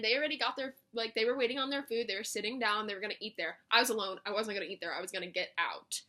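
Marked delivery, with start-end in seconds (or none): none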